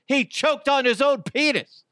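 high, incredulous squeal; disbelieving high-pitched outburst. Real, not acted. Incredulous punchline, pitch jumps.

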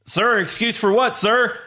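recruit voice. Acted. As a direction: answer like a scared recruit. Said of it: "Do you wanna be Private Cowboy".

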